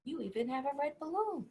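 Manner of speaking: plain reading voice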